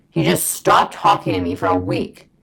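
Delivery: loud, low, hard-hitting repeat; indignant emphasis. Real, not acted. Storyteller stressing a week of being ignored, loud low indignation. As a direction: drop the pitch and push every word out.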